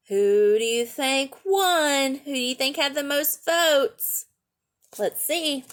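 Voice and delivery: ordinary delivery, nothing marked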